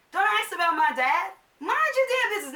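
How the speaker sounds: loud, high-pitched telling-off; acted outburst at a nosy questioner; indignant retort. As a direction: raise voice and pitch, warn them off. Snapping back at the retold asker.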